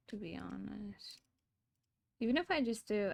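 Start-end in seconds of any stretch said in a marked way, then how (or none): 0.1-1.2 whispered afterthought; whispering. Real, not acted. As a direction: whisper it quietly. Whispered aside.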